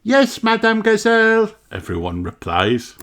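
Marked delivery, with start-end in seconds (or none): 0.0-1.5 high sing-song chorus of pupils; children in chorus. Acted. The class answering their teacher, high and sing-song.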